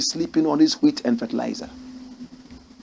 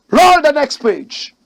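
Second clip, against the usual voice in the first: high, loud and drawn-out command; loud order; 9 semitones higher, 8 dB louder, slower than usual. Real, not acted. Host calling to the crew to roll the next page, loud. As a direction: call it out to the crew.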